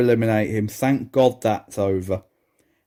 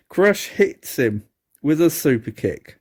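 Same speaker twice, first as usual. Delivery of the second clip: usual speaking voice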